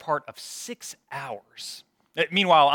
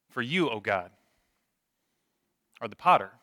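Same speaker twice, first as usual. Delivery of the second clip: solemn prayer; 3 semitones lower than usual. Real, not acted. Leads the congregation in prayer.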